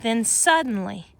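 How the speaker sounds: ordinary delivery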